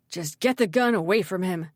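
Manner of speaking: forceful urgent instruction; urgent rush of breath. A narrator's reading. Malachi instructs Ridley as the door opens, urgent. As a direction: push it out in one urgent breath. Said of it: "Malachi added in a rush of breath".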